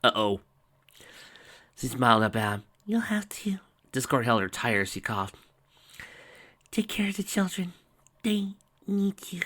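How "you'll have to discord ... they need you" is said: high, whispered and softer; frail high whisper; dying Fluttershy. Acted.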